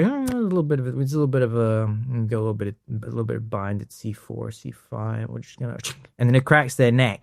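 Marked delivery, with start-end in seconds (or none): 0.0-5.8 imitating a hushed practitioner mid-adjustment; distracting patter before crack. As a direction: murmur absently, trailing ever quieter. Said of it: "like, he'll go".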